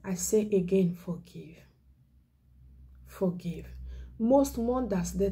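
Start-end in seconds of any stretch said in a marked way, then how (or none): 0.9-1.6 hushed plea. Real, not acted. Pleading with listeners. 3.1-3.7 "Forgive." whispered with no voice; whispered plea. Real, not acted. Evangelist begging congregation to forgive, whispering. Pleading with listeners.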